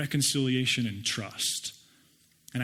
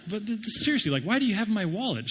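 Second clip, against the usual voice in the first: incredulous; 7 semitones higher than usual. Retold or imitated. Baffled questioning of stranger.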